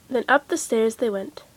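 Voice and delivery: ordinary delivery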